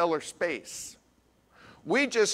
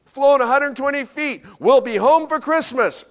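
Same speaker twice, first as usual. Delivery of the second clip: excited telegram; 11 semitones higher, 9 dB louder than usual. Retold or imitated. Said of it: "They said".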